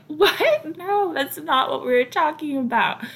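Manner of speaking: high-pitched mock shock; acted-out incredulous denial; retold startled correction. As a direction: jump in high, aghast at the misunderstanding. Retelling their startled correction of Melina.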